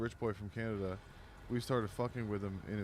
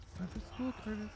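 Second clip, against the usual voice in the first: high-pitched mock mom waking her kid; imitated mom's voice; 10 semitones higher, 4 dB quieter than usual. Prankster to sleeping roommate, posing as his mom in a high voice. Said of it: "I'd make his mom's voice".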